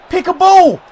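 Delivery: high, loud cry at the chance; tense shout. Real, not acted. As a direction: shout at the screen as the chance goes. Chance in the box.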